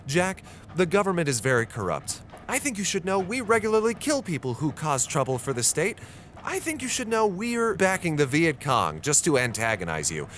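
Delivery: high, mocking imitation of Natalia's frankness; sarcastic mock confession. Jack imagining Natalia's confession, mocking and sarcastic. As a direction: sneer it as the confession she never made. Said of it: "if she had just said".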